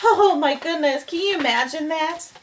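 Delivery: usual speaking voice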